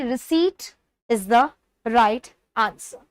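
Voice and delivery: very slow, spaced-out words; slow emphatic answer. Shown as a demonstration. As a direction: draw out each word of the answer. Teacher announces the correct answer. (धीरे-धीरे, ज़ोर देकर)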